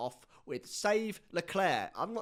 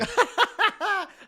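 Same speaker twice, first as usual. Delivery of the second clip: loud burst of laughter; 14 dB louder than usual. Real, not acted. Reacting to vowelsy joke.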